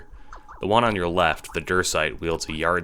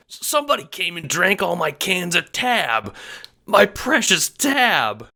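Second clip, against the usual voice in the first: very high, melodramatic lament; anguished wail; 14 semitones higher, 5 dB louder than usual. A narrator's reading. Renegade mourning the stolen tab, anguished. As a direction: wail it, dragging out the last words. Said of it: "in anguish".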